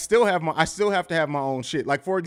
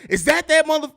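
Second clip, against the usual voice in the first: imitating a startled player; 9 semitones higher, 4 dB louder than usual.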